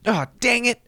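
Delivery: high, whiny yelp; frustrated gamer. Retold or imitated. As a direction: whine like you just lost a match. Gamer losing Smash Bros.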